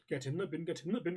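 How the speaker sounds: toned-down, run-together chant; muted repeat. Pretend. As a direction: rattle it off under the big yell. Catchphrase repeated lower and quicker.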